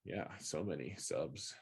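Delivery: mock being impressed; sarcastic. Sarcastic about few subscribers.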